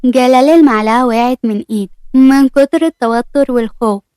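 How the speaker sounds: usual speaking voice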